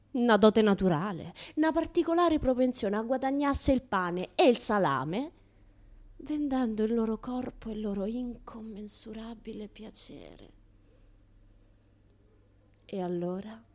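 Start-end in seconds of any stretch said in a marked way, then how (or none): 6.2-13.6 softer, trailing sensual whisper; teasing whispered pleasure; sultry whisper. Acted. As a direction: breathe it out suggestively. Describing prostitutes' pleasure.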